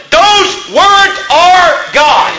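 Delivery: high, slow, every word hammered; forceful proclamation. Real, not acted. Preacher insists to congregation the Bible is God, forceful proclamation. Preacher declaring his central claim.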